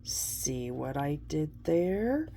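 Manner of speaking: quiet, slow knowing aside; sly aside. Real, not acted. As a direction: nudge them about the pun, softly. Pleased aside after wordplay.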